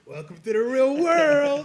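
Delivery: high, loud and very slow, like a movie trailer voice; teasing a crushed newbie in a grand, slow, loud voice; dramatic mock announcement. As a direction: boom it out slowly like a grim movie narrator. Mocking newcomer after rejection.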